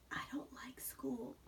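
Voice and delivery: slow soft whisper. Acted. Shy girl whispering into her lap. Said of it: "she whispered into her lap".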